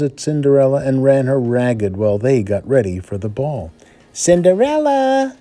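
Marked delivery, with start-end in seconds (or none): 4.1-5.3 shrill high yell of a spoiled stepsister; hammed-up bratty summons; bossy shout. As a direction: bark her name up high and loud. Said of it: "shouted the older stepsister".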